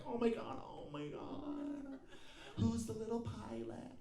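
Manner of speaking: put-on high, slow, soft baby-talk gushing; mock cooing passengers. Passengers gush over the baby, cooing high and slow. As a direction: coo at the baby like a doting stranger.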